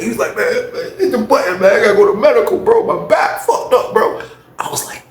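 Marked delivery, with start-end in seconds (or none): 0.4-4.2 high whining plea for help; imitated injured man; mock pained plea. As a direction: beg in a high, hurt voice. Imitating injured man begging for medical.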